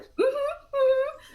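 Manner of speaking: high, choked mm-hmm holding back tears; a child pretending she's fine through tears; imitating a daughter fighting tears; tearful agreement. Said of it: "She's like".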